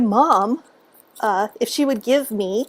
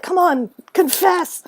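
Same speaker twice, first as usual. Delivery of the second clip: mock whisper; 7 semitones higher, slower than usual. Teachers pressing her to confess.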